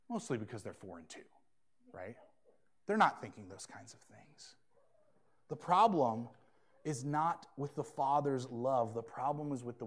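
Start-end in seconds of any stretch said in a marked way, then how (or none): 0.4-4.5 confiding hushed aside; whispered aside. Real, not acted. Aside about his small daughters.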